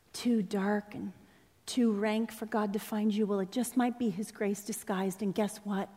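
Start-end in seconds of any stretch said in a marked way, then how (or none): none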